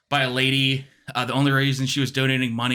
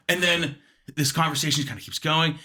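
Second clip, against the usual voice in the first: usual speaking voice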